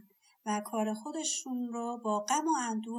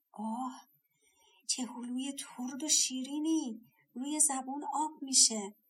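savouring delight; 4 semitones higher than usual. A narrator's reading. Old woman tasting a sweet peach, delighted. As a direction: draw out the pleasure of tasting.

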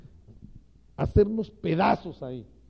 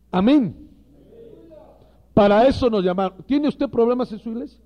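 loud, emphatic pulpit preaching; fiery sermon voice, hammering each phrase; preaching at full force; 3 semitones higher, 7 dB louder than usual. Acted. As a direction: drive the point home to the ministers.

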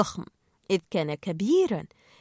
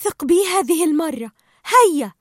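higher, louder Dory urging him on; Dory pleading; 5 semitones higher, 6 dB louder than usual. Acted. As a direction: coax him to trust her. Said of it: "فقالت دوري". (دوري تلحّ بصوت أعلى)

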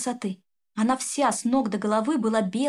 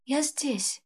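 softer, gentle voice; tender voice; 4 semitones higher, 4 dB quieter than usual. Acted. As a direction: answer gently from the light. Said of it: "раздался нежный голос". (нежным голосом)

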